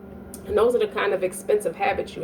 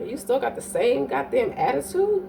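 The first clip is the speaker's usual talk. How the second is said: ordinary talk, nothing marked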